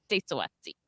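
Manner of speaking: low, soft, slurred catchphrase; pretend drunk. Streamer imagining herself drunk, pretend tipsy catchphrase. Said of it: "when I start getting drunk".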